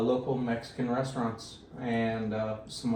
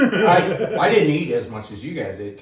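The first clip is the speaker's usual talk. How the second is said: louder, higher, laughing; laughing loudly; 5 semitones higher, 9 dB louder than usual. Real, not acted. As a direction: burst out laughing. Laughing after spicy bite.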